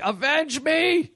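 slow, high, hammed-up dying cry; overacted death scene. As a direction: wail it out like a hammy death scene. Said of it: "the movie where he's like".

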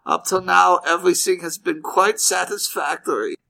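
high, stiff little voice; a hint of a formal German child; prim German kid. German kid explaining his silence to mother, prim and high. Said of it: "the little kid goes".

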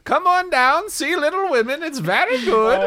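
high, squeaky carnival barker pitch; bright squeaky sing-song welcome. Acted. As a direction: sing it out like a cheerful huckster. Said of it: "if this is your character voice".

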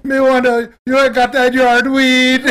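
loud, high, drawn-out Jamaican patois; mock Jamaican. Host parodying the narrator's accent, hammed-up Jamaican. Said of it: "He sounds Jamaican".

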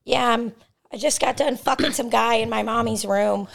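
high silly pet voice; cheeky high cat voice. Acted. Voicing the cat.

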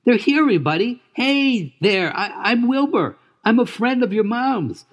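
put-on high, eager young pig voice; excited gentle pig, proud and tender; Wilbur voice. Wilbur greets Charlotte's daughters.

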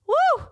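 a high whooping breath to hold back tears; tearful woo. Real, not acted. Host tearing up over her dark months, high woo. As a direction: blow out a woo to stop yourself crying. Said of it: "I'm crying thinking about".

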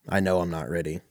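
ordinary delivery

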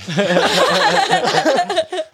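high, loud burst of laughter; cracking up at the story; big laugh. Real, not acted. Friend cracks up at the firing punchline, loud high laugh.

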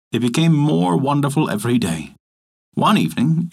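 ordinary delivery

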